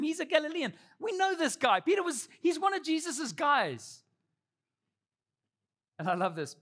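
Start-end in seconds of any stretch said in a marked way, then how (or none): 0.0-4.0 high, excited finger-pointing; pitched-up accuser calling someone out; insistent accusation. Acted. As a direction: accuse him in a raised pitch. Bystander accusing Peter, acted out.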